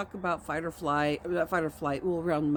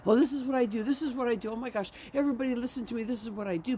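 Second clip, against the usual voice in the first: mock show-off; 6 semitones higher, faster than usual. Mocking show-off self-promoter.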